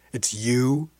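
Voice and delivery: drawn-out question, rising at the end; disbelieving repeat. A narrator's reading. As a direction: repeat it slowly as it sinks in. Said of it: "she said again".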